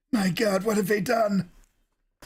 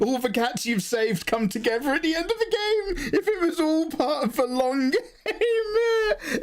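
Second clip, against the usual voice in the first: excited; 12 semitones higher than usual. Real, not acted. Excited rant about cats saving the day.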